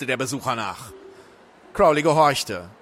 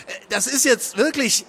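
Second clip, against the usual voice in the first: high, hesitant start; flustered protest; 10 semitones higher than usual. A narrator's reading. Crowley reaching out to Megan, flustered protest. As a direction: try to placate, flustered.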